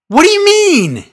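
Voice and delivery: high, loud baffled cry. Real, not acted. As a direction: shout it in disbelief. Baffled outburst.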